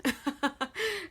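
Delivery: high-pitched burst of chuckles; amused laugh. Real, not acted. Reader amused by her own burger joke, laughing.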